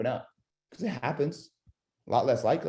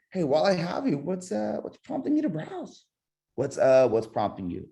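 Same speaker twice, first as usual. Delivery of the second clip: loose, natural "what's, uh" hesitation; little stutter; 6 semitones higher than usual. Shown as a demonstration. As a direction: stumble once, keep it casual. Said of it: "Do a little stutter".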